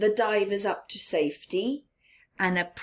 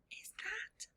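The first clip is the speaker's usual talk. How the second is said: hushed, excited question; whispered question; 12 semitones higher, 22 dB quieter than usual. Real, not acted. Reader whispering to the listening children in excitement.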